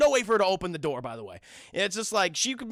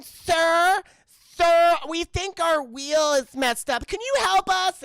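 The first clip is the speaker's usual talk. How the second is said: higher, louder, slow pleading; mock pleading girls; 8 semitones higher, 5 dB louder, slower than usual. Mocking the girls begging the German man, high plea. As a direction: beg the stranger for help, high and loud. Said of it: "And they're saying".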